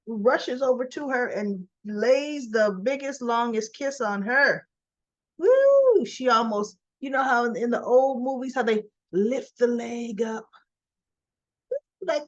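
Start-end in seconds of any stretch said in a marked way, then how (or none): 5.4-6.0 high, loud whoop of delight; excited whoop. Real, not acted. Recapping the soap's big kiss, a thrilled whoop. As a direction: cheer it like a fan at the screen.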